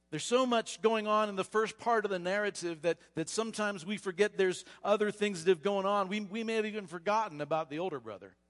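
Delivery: normal talking voice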